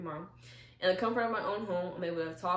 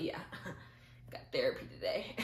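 low, hushed flex broken by a chuckle; laughing brag; 4 semitones lower, 4 dB quieter than usual. Acted. Joking to viewers about flexing therapy, laughing brag. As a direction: brag under your breath, laughing.

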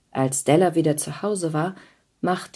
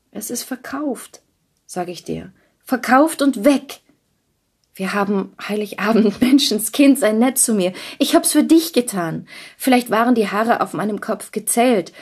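Della high and urgent, begging him not to mind; a young wife pleading, a little raised and anxious; 5 semitones higher, 4 dB louder than usual. A narrator's reading. Della pleads with Jim over her cut hair, high and urgent.

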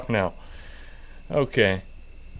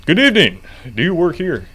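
cop voice; 5 semitones higher, 9 dB louder than usual. Pretend. Voicing the police officer.

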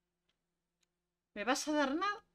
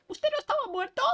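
very high squeaky shocked question; catchphrase tone; 4 dB louder, faster than usual. Shown as a demonstration. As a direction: squeak it out for the clip. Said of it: "saca clip de esto".

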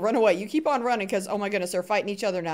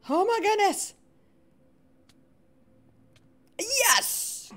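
excited cheer; 11 semitones higher, much slower than usual. Real, not acted. Teammate wins the fight.

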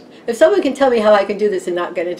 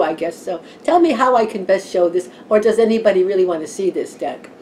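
normal talking voice

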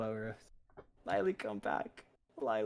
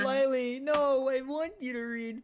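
high pleading voice; 9 semitones higher, much slower than usual. Real, not acted. Pleading with Lily to return.

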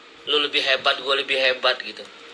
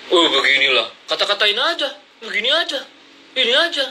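mocking rival; 5 semitones higher than usual. Mocking rival's opinion-steering.

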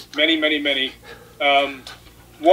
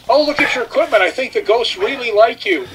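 raised, bright mock girl voice; girl's teasing voice; 6 semitones higher than usual. Woman at the saloon teasing Rick, in a lightly voiced higher tone. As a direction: lift it up to voice the girl teasing you. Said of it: "And she goes".

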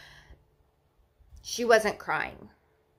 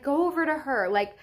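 higher-pitched retold plea; retold urging; 6 semitones higher than usual. New mother sending partner to baby, urgently. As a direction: urge him away from you, voice lifted.

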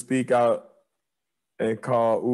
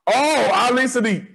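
excited; 13 semitones higher, 4 dB louder than usual. Real, not acted. Excited recall of comedian's name.

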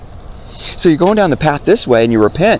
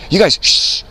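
higher-pitched mimicked shushing; mock hushing; 7 semitones higher than usual. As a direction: shush them as a dismissive imitation. Culture hushing Christians.

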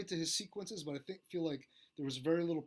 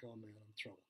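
much lower and softer, muttered to himself; mock despair under his breath; worried inner thought; 6 semitones lower, 14 dB quieter, faster than usual. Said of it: "I'm like".

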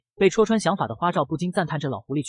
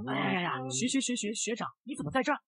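soft, higher flustered stutter; a caught-out kid stammering to his senior, softer and higher; stammering in panic; 5 semitones higher, 9 dB quieter than usual. Acted. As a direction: trip over the words, caught out and scared. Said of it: "结结巴巴地说道".

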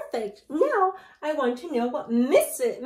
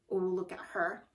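ordinary delivery

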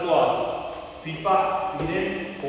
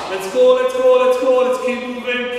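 loud, higher motivating shout; coach shouting; 6 semitones higher, 6 dB louder than usual. Real, not acted. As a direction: pump the class up loudly. Coach calling out during workout.

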